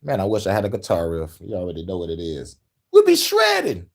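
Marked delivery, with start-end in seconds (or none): 2.9-3.9 hyped. Real, not acted. Miming a guitar riff.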